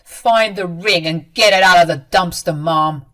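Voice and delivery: loud, firm command; stern order. A narrator's reading. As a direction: lay down the law to mother. Son orders mother.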